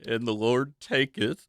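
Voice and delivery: slow, soft mournful line. Acted. Co-host finishing the verse in grief, slow mournful. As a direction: let it fall like a eulogy.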